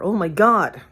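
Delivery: ordinary delivery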